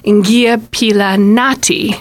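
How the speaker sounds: Ngiyaphila Nati said slowly, stress on Nati; Zulu reply. Shown as a demonstration. Teacher slowly modelling Ngiyaphila Nati for the host. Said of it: "the next person would say".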